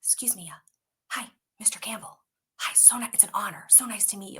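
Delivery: soft, half-whispered polite introduction; timid, hushed hello to a star; hushed fan greeting. Retold or imitated. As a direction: imitate awed fans, very softly. Retold fans meeting a star.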